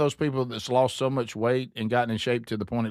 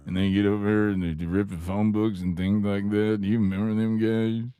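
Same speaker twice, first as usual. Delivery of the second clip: sleepy caller impression; 6 semitones lower than usual. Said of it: "you sounded so sleepy".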